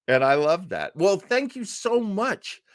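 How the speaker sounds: ordinary talk, nothing marked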